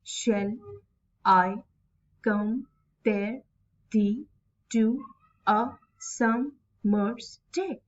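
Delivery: "Shall I compare thee to a summer's day?" chopped into separate syllables. Shown as a demonstration. Teacher showing students how to count syllables, slowly separated. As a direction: leave a clear gap after each syllable. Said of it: "while focusing on the pronunciation and syllables".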